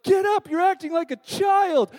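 high, loud scolding shout; angry yell. Retold or imitated. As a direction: shout at him like a fed-up teammate. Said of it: "And they're like".

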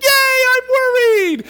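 put-on high, loud happy squeal of a three-year-old; gleeful shout of worry; excited toddler cheer. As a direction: cheer it out like a delighted little kid. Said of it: "He's like".

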